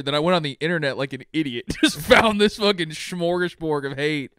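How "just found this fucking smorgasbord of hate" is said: ordinary talk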